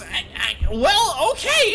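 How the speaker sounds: very high goblin squeak, lost for words; a stunned goblin giving up; flabbergasted goblin. Acted. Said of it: "He just flabbergasted and goes".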